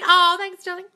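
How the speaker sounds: loud, high-pitched gushing thanks; delighted thanks. Real, not acted. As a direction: squeal your thanks at a compliment. Thanking a fan for a compliment.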